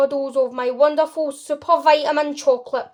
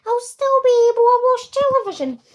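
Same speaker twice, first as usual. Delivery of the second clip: much higher, louder squeaky protest; tiny squeak; 10 semitones higher, 5 dB louder, faster than usual. Acted. Shrunken boy squeaks back at his mother, high and defiant. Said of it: "squeaked the tiny voice".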